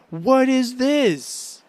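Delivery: amazed slow what-is-this; excited disbelief. Real, not acted. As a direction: gasp it out in stunned delight. Discovering a bizarre episode.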